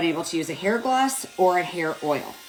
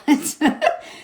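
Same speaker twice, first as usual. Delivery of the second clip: short airy laugh at herself; a quick embarrassed laugh; light self-mocking chuckle; breathy laugh; 8 semitones higher than usual. Real, not acted. Laughs at gray hair comment.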